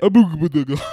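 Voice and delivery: high loud mask chant; cartoon mask voice; mask guy voice. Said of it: "mask guy voice".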